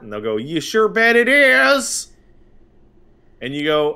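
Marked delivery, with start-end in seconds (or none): 0.4-2.1 high, slow, chipper customer-service voice; cheery clerk. Retold or imitated. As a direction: sing it out like an overly friendly clerk.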